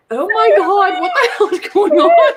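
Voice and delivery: high, loud bewildered panic. Retold or imitated. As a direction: squeal in baffled alarm, laughing. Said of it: "Russ is looking at me like".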